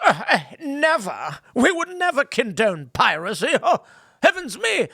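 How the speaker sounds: mock the British denying piracy with a laugh; fake-innocent Englishman. British crown feigning innocence.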